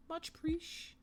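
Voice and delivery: a cutesy high little thank-you; tiny high cartoon voice; squeaky voice.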